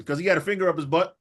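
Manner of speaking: ordinary talk, nothing marked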